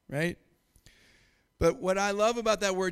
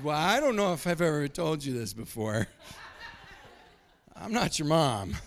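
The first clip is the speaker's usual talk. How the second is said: laughing; 3 semitones lower than usual. Real, not acted. Telling his brother about the text.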